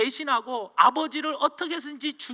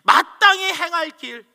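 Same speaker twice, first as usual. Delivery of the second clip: loud, high, impassioned preaching; fiery preaching; 6 semitones higher, 7 dB louder than usual. Acted. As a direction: drive the message home to the congregation. Preaching at full force. (온 힘을 다해 외치는 설교)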